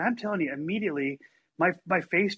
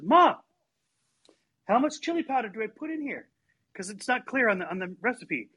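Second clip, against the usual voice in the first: yelling across the house; 6 semitones higher than usual. Retold or imitated. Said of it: "she yells at my mom".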